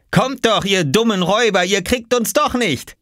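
put-on high, gleeful boyish taunt; taunting call. A narrator's reading. Oskar to the robbers outside, taunting. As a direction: dare them in a high, cocky voice.

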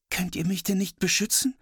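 put-on high, pleading little girl. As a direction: beg them for help like a small girl. Sister pleads with brothers.

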